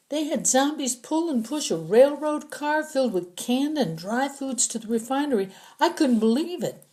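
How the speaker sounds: high, anxious captive voice; a scared prisoner explaining to his captors; frightened prisoner. Acted. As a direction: talk fast to save your skin.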